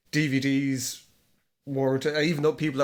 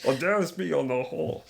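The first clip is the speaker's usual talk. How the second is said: lightly imitating Arnold Schwarzenegger; Austrian accent; 5 semitones higher than usual. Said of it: "In an Austrian accent".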